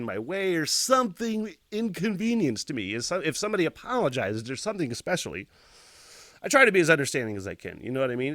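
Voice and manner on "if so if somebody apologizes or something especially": normal talking voice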